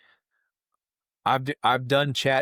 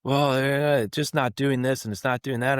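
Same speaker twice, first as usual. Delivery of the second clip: raised-pitch imitation of a hedging complainer; flustered complaint; 7 semitones higher than usual. Customer explaining the gun's faults to the president, flustered. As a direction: stumble into a mock defensive gripe, higher.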